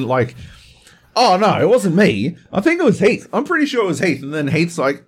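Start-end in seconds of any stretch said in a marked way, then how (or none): 1.1-4.2 louder, higher blame-shifting; heated denial. Retold or imitated. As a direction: push the blame away hard. Imitating Drew arguing.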